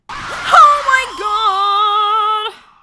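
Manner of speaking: real scream, high and loud; terrified shout. Real, not acted. Player caught by a ghost, screaming loud. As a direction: yell out at the scare. Jump scare in game.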